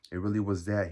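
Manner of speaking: firm admission; resolved. A narrator's reading. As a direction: say it with mind made up. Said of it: "as though he had made up his mind".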